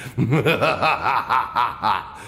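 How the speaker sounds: evil laugh. Acted. Boss laughs after threat.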